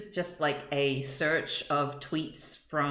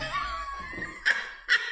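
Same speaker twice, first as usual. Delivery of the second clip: shrieking laugh. Real, not acted. Giddy about German word.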